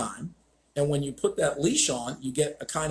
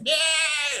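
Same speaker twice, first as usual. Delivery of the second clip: high-pitched mock dog scream; 13 semitones higher, 4 dB louder than usual. Imitating a screaming little French bulldog, high mock scream. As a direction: imitate a little dog's screech. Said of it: "that was screaming".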